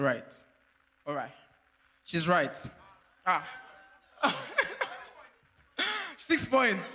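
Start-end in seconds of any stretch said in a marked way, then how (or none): 4.2-5.0 quick high burst of laughter; real amused laugh. Real, not acted. Host laughs at answer. 6.2-7.0 laughing while saying. Real, not acted. Laughing at score.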